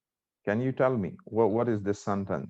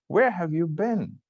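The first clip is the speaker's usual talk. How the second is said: higher-pitched curious question; 7 semitones higher than usual. Shown as a demonstration. Teacher showing students a question's tone, curious. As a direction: ask with open curiosity, pitch lifted. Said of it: "So I'm curious".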